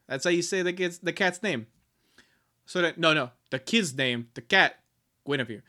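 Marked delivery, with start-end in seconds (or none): none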